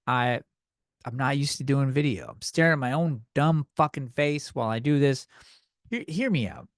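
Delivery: normal talking voice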